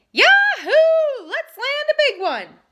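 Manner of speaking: very high, very loud whoop; too loud. A narrator's reading. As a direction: shout it in excitement. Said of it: "his words came out too loud".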